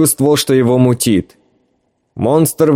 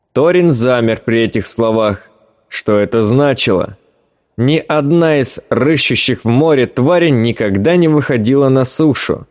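ordinary delivery, nothing marked

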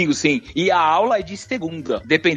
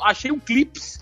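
"clips" said in a higher mocking voice as a São Paulo speaker would; mock paulistano; 7 semitones higher, slower than usual. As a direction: parody the São Paulo singular "clips", pitched up. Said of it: "quantos você achou".

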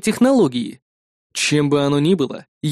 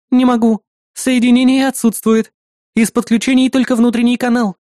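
high, louder status report; female AI assistant; 8 semitones higher, 5 dB louder than usual. Acted. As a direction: report failure as the hero's helper.

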